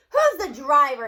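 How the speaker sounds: loud furious demand; raging. A narrator's reading. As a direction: bellow it at the driver in a rage. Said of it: "he rages".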